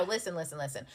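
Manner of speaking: ordinary delivery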